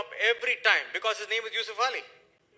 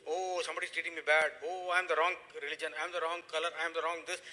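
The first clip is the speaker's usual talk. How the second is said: mocking whine; 4 semitones lower, 5 dB quieter than usual. Mocking people playing victim.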